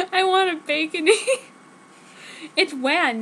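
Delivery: giggling through the words; real laughter breaking the reading; laughing. Real, not acted. Reader cracking up at her own story, laughing.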